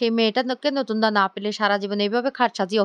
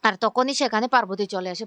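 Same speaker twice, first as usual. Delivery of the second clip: usual speaking voice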